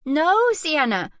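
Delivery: put-on alarmed shout at the child. A narrator's reading. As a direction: yell it to stop the child. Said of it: "I shout again".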